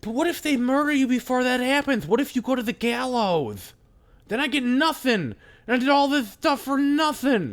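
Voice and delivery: put-on a little kid complaining he won't get paid; high kid voice. The courier kid protesting to the guard in a high whine.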